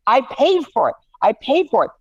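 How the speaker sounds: high whiny kid insisting, imitated; mock a ten-year-old protesting she paid; imitating upset child. Dad voicing daughter insisting she paid, high mock whine. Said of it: "my ten-year-old's like".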